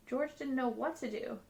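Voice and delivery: ordinary delivery, nothing marked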